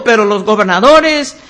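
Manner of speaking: ordinary delivery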